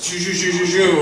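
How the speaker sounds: rapid mouth-made bullet whizzes; imitated gunfire. Comic mimics a rubber-bullet drive-by, whooshing shot sounds. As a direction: rattle off mock shots as repeated shoo sounds.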